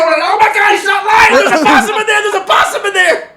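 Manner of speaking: frightened shouting. Retold or imitated. Speaker seeing the possum himself, high laughing shouts. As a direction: yell it as the thing jumps at you.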